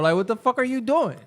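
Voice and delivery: ordinary delivery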